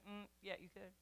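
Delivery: deadpan it almost under the breath; mock dry God. God answering an excuse, soft deadpan.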